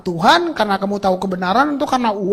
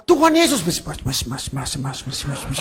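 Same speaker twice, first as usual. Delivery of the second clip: imitating a mumbled whispered prayer, much softer and lower; mock whispered prayer; 10 semitones lower, 4 dB quieter than usual. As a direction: mimic someone mumbling a prayer under breath. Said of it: "lalu bisik-bisik". (tiruan doa berbisik)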